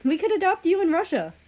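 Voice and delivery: thrilled proposal; excited. A narrator's reading. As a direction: pitch the plan with joy.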